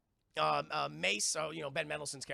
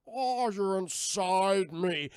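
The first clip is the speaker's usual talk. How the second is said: mock slug guy; 7 dB louder, much slower than usual. Slug guy voiced in recap.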